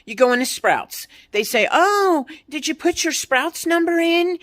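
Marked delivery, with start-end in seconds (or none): 1.7-4.4 higher, chirpy mock of the cashier asking about the number. Ranting about store apps, mocking a Sprouts cashier in a higher voice. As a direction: parrot the cashier's canned question, pitched up.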